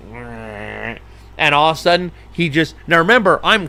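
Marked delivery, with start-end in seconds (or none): 0.1-1.0 very soft, low bored meh; dismissive pelican grunt; barely voiced deadpan meh; unimpressed. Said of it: "he's looking over at me like".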